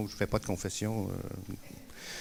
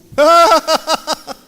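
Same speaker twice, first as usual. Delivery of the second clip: burst of high laughter; 19 dB louder than usual. Real, not acted. Laughs at own joke "je suis fou.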